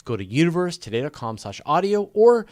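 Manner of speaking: upbeat sign-off read to the audience; announcer outro. Acted. Host wrapping the live show for viewers, upbeat announcer read. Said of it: "I'll do the other outro".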